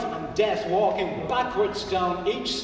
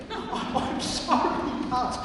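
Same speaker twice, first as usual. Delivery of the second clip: breathy, slow, stifled laughing apology; Michael apologising to Pat while giggling; gasping through laughter; 5 semitones higher, slower than usual. Acted. Stifled laugh. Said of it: "I gasped".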